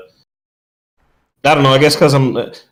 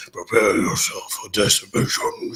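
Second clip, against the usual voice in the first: slow ominous villain voice; little voice; 3 semitones lower, 6 dB quieter, slower than usual. Retold or imitated. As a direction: menace it out, slowly, like a game boss. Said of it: "you hear a little voice to the side".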